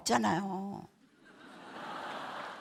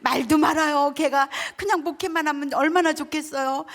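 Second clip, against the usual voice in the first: high, loud retold complaint; worked-up venting, acted out; exasperated sister; 6 semitones higher, 5 dB louder than usual. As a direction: complain to an elder, mildly imitated anger. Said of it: "언니가 지금 막 화가 나 있잖아요".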